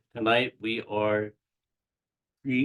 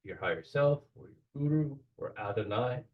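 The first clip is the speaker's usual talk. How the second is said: guided meditation. Acted. Guiding the group through the Kabbalistic cross, in a calm meditation voice. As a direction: lead the listeners gently into the ritual.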